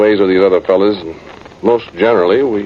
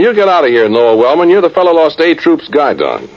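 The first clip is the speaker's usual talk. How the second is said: hollered higher and louder; retold holler; 11 semitones higher, 4 dB louder than usual. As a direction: yell it across the room to throw him out. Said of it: "some fella hollers at me".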